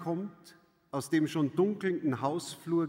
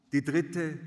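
ordinary delivery, nothing marked

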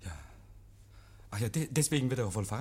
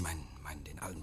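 geflüstert; 9 semitones lower, 10 dB quieter, faster than usual. Acted. Oak stellt sich ahnungslos.

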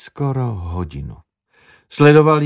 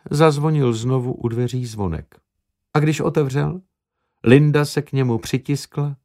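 plain reading voice

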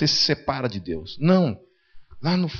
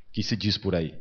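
ordinary talk

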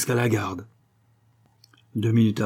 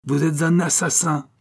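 higher-pitched, indignant charge; angry accusation; 6 semitones higher than usual. A narrator's reading. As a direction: cut in with the accusation. Enraged brother accuses duke. (accusation furieuse)

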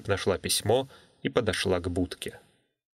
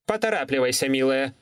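calling out; 4 semitones higher than usual. Acted. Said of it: "крикнула она".

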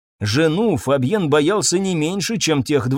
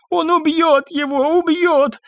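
very high, drawn-out wail; frightened maid's high lament; panicked babbling; slower than usual. A narrator's reading. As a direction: whimper it in terror, pitch way up. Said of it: "лепетала Люсинда".

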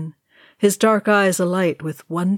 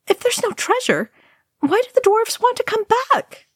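put-on very high, boyish voice; young hobbit. A narrator's reading. Pippin asking about the dwarves, high youthful voice. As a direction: ask naively in a piping high pitch. Pippin's innocent question in story.

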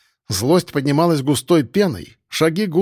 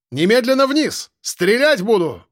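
shouted order; 8 semitones higher than usual. Acted. Police officer orders from below.

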